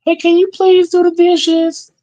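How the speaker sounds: very high, slow, sweet asking voice; mock gentle parent. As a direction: falsetto nice-parent request. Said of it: "to a kid nicely".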